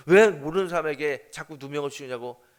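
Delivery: loud snapping retort; angry retort. Retold or imitated. [name] reliving how he snapped at the girl, loud. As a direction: fling it back in irritation.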